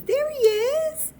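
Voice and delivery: high, loud excited squeal of words; excited, high-pitched and loud; delighted reveal. Real, not acted. Reveals the bear woke up.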